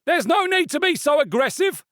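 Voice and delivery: high, loud rebuke; man in the crowd reproaching the fishmonger; indignant bystander. A narrator's reading. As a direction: scold him loudly. Bystander restrains stallholder.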